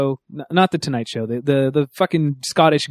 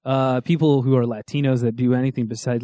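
ordinary talk

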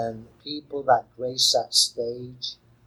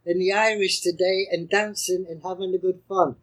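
higher, stage Irish lilt; Irish accent; 5 semitones higher than usual. Pretend. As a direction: put on a jolly Irish accent. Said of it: "I'm gonna go into my Irish accent".

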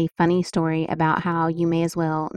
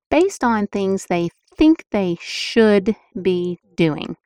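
should leaned on, slow and weighted; slow stress landing on should; emphasis on should; 3 dB louder, slower than usual. Shown as a demonstration. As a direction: press hard on should. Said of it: "I'm sure you heard I put emphasis on the word should".

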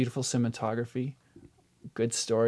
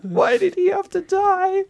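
mock wail; 7 dB louder than usual. Mock lament over Doctor Who.

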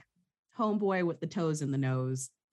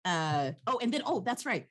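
ordinary delivery

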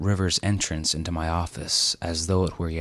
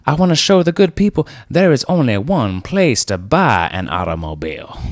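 loud, high, salesman bluster; bossy car dealer; 5 semitones higher, 5 dB louder than usual. Acted. Car dealer Rivers pitching his family-friend adverts, blustering. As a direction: sell it like a TV pitchman.

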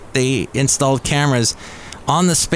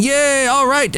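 high, excited cheer; a hammed-up crowd cheer; mock cheering; 12 semitones higher than usual. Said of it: "they're cheering".

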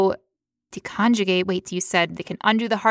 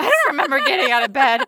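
bewildered snacker; 4 semitones higher, faster than usual. Pretend. Imagined sleepwalking self.

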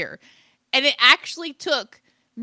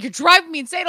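loud, higher, lightly mocked exasperation; exasperated partner; 8 semitones higher, 7 dB louder, faster than usual. Retelling Jacob's complaint, mock exasperated. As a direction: imitate a fed-up partner. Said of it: "Jacob's like".

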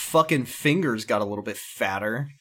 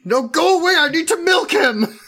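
high, hammy dramatic wail; melodramatic; 5 dB louder than usual. Acted. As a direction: overact a tearful refusal. Mock dramatic soap opera scene.

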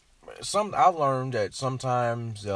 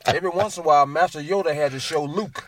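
loud teasing; 8 semitones higher, 11 dB louder than usual. Real, not acted.

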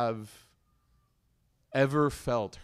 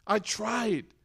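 fed-up retort; 9 semitones higher, 3 dB louder, slower than usual. Retold or imitated. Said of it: "He doesn't say".

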